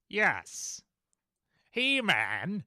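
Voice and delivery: Skeletor voice. Acted. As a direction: sneer it like Skeletor. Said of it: "Skeletor voice".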